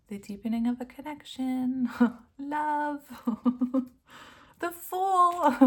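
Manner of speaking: high, loud, laughing card names; giddy excitement. Real, not acted. Reader giggling with delight as the cards match.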